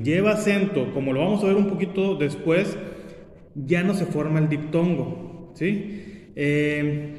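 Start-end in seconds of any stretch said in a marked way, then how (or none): none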